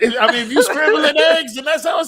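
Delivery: high, loud laughing protest; laughing hard. Real, not acted. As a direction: push the words out through laughter. Laughing defence after being teased.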